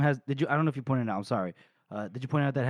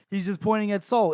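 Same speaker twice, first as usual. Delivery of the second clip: ordinary delivery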